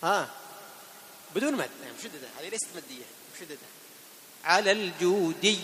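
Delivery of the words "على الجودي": الجودي slow and loud, ending on a doubled ya; correct shadda. Shown as a demonstration. Teacher models الجودي for the class. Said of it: "هكذا".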